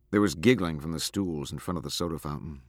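ordinary delivery, nothing marked

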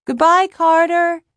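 high, loud, drawn-out call; shouted goodbye. A narrator's reading. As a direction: shout a long goodbye after a friend. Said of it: "he shouts".

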